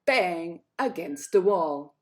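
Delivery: loud, slow, punched words; slammed bang. A narrator's reading. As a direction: land it like a hard throw. She throws frog against wall.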